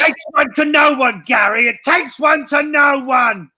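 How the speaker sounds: shouting puppet. Acted. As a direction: shout it back at the heckler. Puppet shouts back at a viewer.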